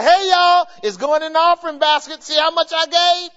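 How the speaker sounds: loud, high bragging giver; showing off the offering, loud and high; mock show-off. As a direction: ham up a showy church donor. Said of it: "throw it up in the air and say".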